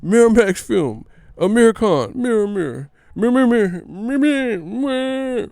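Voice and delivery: high, loud botched attempts breaking into repeats; Tim Bradley, stammering. Mocking a commentator who was tripping, high loud stutter. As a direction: stammer wildly, rising toward a whine. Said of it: "He was tripping".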